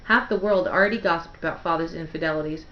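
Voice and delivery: ordinary delivery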